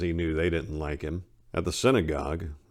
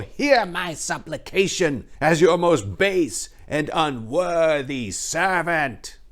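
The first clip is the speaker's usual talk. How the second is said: much higher, louder, drawn-out fawning flattery; bow and scrape while hiding contempt; mock reverence; 10 semitones higher, 6 dB louder, slower than usual. A narrator's reading. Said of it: "In a voice filled with mock reverence to conceal his disdain".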